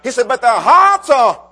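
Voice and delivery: loud, rising preacher's cadence; fervent loud preaching. Acted. Preacher rousing his congregation, loud fervent preaching. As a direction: preach it out to the back pews.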